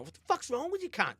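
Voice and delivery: high but soft mock scream at oneself; retold self-scolding. Driver screaming at himself, retold in a strained high voice. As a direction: imitate screaming at yourself without the volume. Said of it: "I'm screaming at myself".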